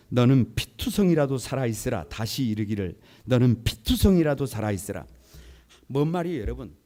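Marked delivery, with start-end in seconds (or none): 0.1-5.1 loud scripture reading. Real, not acted. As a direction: proclaim 피투성이라도 firmly and loudly. Congregation reads scripture aloud together.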